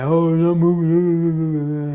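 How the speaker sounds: sneering slow loudspeaker nag; mocking boss on loudspeaker. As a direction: imitate the boss nagging over the speaker. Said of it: "You come over the loudspeaker and say".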